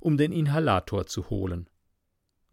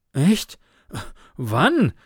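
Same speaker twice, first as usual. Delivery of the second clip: higher, louder panted question; winded, each word on its own breath; panting; 4 semitones higher, 4 dB louder than usual. A narrator's reading. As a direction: puff the words out in surprise. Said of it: "gab Karl keuchend zurück".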